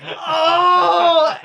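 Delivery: very high, loud, long wail; hammed-up howl of horror; horrified cry. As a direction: cry out as the secret is exposed. Retelling the moment he is exposed.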